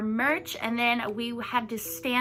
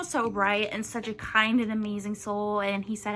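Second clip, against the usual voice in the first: ordinary talk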